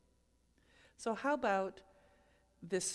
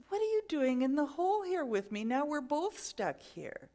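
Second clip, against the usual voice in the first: high, loud startled question; shocked disbelief; 7 semitones higher, 6 dB louder than usual. Retold or imitated. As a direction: react as the friend lands beside you. Friend jumps into the hole.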